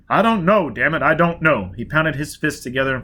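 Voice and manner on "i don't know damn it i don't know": frustrated. Acted. Foyle in frustration.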